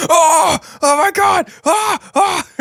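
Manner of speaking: loud, high panicked screams; screaming. Acted. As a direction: scream in terror. Screaming at the spider.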